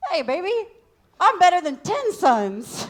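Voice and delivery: high, loud, slow mocking husband voice; mock clueless husband. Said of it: "He's like".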